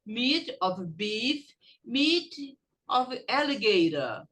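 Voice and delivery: slow, measured phrases for students to echo; slow teacher's model, word by word; carefully spaced language drill; repeat-after-me drill. Shown as a demonstration. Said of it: "Repeat after me".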